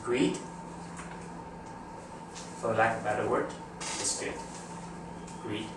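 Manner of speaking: low, hushed monologue with long deliberate pauses; quiet, measured movie monologue, pausing after key words; movie speech reenactment. Acted. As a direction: reenact a film speech, softly and weightily. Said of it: "I want to reenact, reenact that particular scene".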